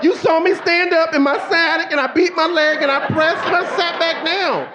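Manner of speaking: high-pitched mock outrage; indignant rant. Husband scolding wife who jumped on him, high indignant protest.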